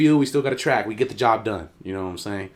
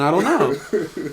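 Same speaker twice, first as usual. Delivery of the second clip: usual speaking voice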